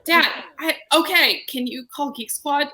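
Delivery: high-pitched mock exasperation with dad; exasperated daughter. Daughter fending off dad's tech questions, high exasperated pleading. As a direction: beg off, pitched well up.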